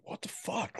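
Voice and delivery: breathy whisper of disbelief; whispered what the fuck. Retold or imitated. Husband whispers his shock at the new vine. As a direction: hush it out in shock.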